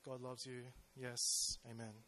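apathetic. Retold or imitated. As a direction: say it like you've heard it a thousand times. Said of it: "you might feel a bit apathetic".

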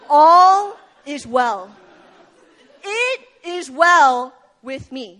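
slow, loud, drawn-out insistence; insistent all is well. Acted. Preacher voicing the woman's defiant reply, slow and loud. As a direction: declare it slowly and loudly, laughing through it.

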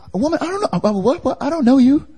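high-pitched, stammering mock confusion; feigned incomprehension. Peter denying to the servant girl, acting baffled. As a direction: play dumb in a high, baffled voice. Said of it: "acts like he doesn't even understand what she's saying".

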